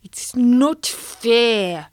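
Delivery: slow, grumbling carp; a cross carp grumbling to himself in his cave; cross sulk. Acted. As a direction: drag it out like a sulking fish. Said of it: "really cross".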